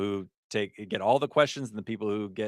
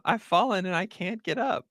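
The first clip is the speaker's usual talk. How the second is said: high-pitched ad catchphrase; mock cry for help; 8 semitones higher than usual. Quoting a great old ad, high mock plea for help. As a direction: imitate the ad's plea, pitched right up.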